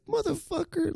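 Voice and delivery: sudden high-pitched cry of disbelief; exasperated outburst. Retold or imitated. To the boss about the delay, a high outraged yelp. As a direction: let it fly, outraged.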